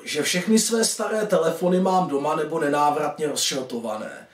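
plain reading voice

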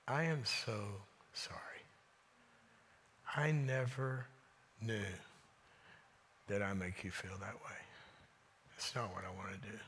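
very soft, low, halting apology; retold heartfelt apology. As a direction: drop almost to a hush as you own up.

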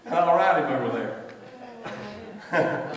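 punchline broken by laughter; can't hold back the laugh; laughing through it. Retold or imitated. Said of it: "Billy Graham says".

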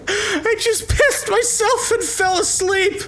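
high, slow words through laughter; high laughing disbelief. Real, not acted. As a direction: squeeze it out still laughing. Reacting after wild laugh.

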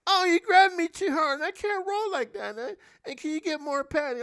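high, mocking falsetto of a fussy actress; dainty actress. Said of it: "they soft, dainty".

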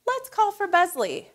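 usual speaking voice

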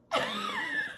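long high-pitched laugh; helpless laughter. Real, not acted. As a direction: laugh until the words won't come. Overcome by her own story.

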